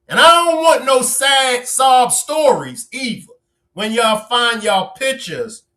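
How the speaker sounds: high-pitched playful scolding; mock warning. Real, not acted. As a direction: lay down the law, teasingly high. Mock warning continues after laugh.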